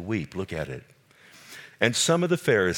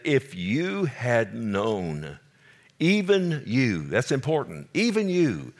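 rising preaching lament; 4 semitones higher, slower than usual. Acted.

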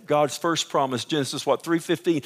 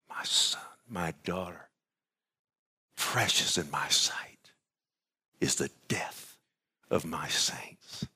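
slow, low whispered tenderness; 11 semitones lower, 10 dB quieter than usual. Acted. God comforting his son and daughter in a slow whisper. God's words to his saints.

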